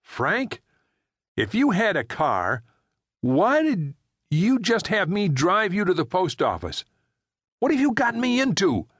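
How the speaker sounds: alarmed Jerry. Acted. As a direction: press him, rising in alarm. Jerry confronts Frank.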